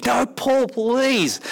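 high, begging please; hammed-up pleading crowd; whining plea. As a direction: plead like a child. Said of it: "the Corinthians are like".

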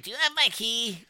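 high innocent imitation; mock high drunk voice. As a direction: ask it high like a lost child. Drunk woman asking.

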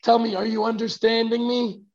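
usual speaking voice

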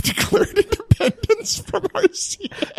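laughing through the words; high, breaking into laughter at the absurdity. Real, not acted. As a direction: crack up while finishing the sentence. Laughing at own story.

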